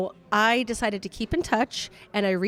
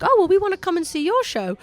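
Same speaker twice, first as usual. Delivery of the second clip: raised, louder imitation of eager bandmates; imitated British bandmates; 9 semitones higher, 4 dB louder than usual. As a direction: lightly mimic keen British musicians, pitched up. Voicing the eager British band.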